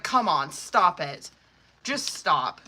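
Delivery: ordinary talk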